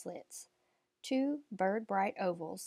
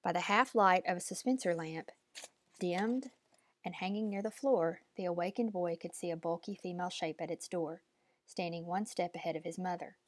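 usual speaking voice

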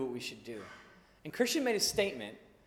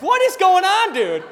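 much higher and louder, laughing; laughing disbelief; 13 semitones higher, 14 dB louder than usual. Retold or imitated. To his friend driving past every exit, laughing loud disbelief. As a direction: cry out in amused confusion. Baffled as drive goes on.

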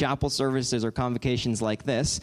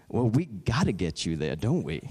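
lightly voiced airline agent; 5 semitones lower than usual. Airline agent offers help to a traveler, lightly voiced. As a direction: lower voice, dropping deep at the end.

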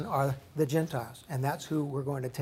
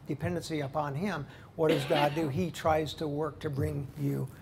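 ordinary talk

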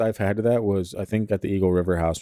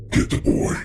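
a louder breathy ghost rasp; louder raspy whisper; 3 dB louder than usual. Retold or imitated. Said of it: "It was much louder this time".

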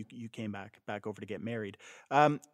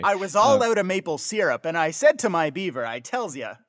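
hammed-up, louder Canadian caricature; mock Canadian accent; 5 semitones higher, 5 dB louder than usual. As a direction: tease with a put-on Canadian accent. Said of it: "it's sounding more Canadian".

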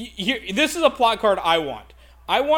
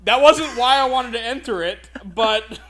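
laughing; 5 semitones higher, 5 dB louder than usual. Retold or imitated. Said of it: "I'm just like".

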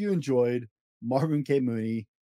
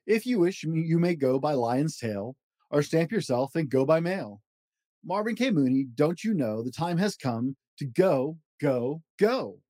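lively read-aloud of a rhyming picture book; storybook voice, playful and rhythmic; reading to children; 5 semitones higher than usual. Acted. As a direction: bounce through the rhymes for a child at bedtime. Reads Dr. Seuss book aloud.

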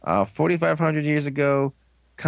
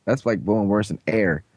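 ordinary delivery

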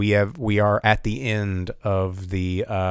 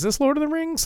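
incredulous; faster than usual. Retold or imitated. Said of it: "you'll be like".

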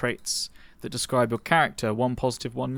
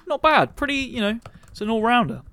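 high squeaky voice; 9 semitones higher, 3 dB louder than usual. Acted. Sudden high squeaky comment.

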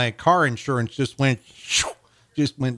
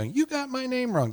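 mock complaint; 12 semitones higher, 5 dB quieter than usual. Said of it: "an angry email".

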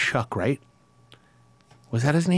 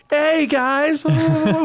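breezy sound guy greeting the band; cheerful greeting; slower than usual. Retold or imitated. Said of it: "and then you were like".

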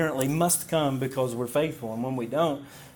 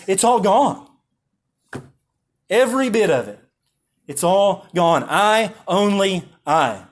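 loud, raised, broken into short bursts; acted breathless urgency; 5 semitones higher, 5 dB louder than usual. Preacher playing the wounded servant running in to Job, urgent. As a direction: imitate a desperate messenger, pausing between phrases. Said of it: "And without breath, he says".

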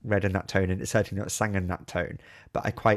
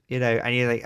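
ordinary delivery

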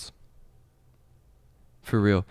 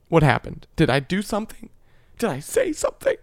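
rising higher with each question; mock desperation; 8 semitones higher than usual. Voicemail demanding what went wrong, increasingly desperate. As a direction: build to a high, overwrought demand.

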